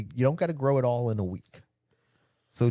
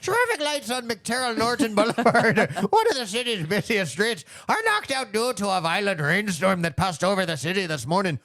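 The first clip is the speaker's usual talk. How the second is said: higher, amateur Irish accent news read; 7 semitones higher than usual. Shown as a demonstration. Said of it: "read this in an Irish accent".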